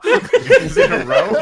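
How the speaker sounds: co-host mocking the line through laughter; laughing mock question.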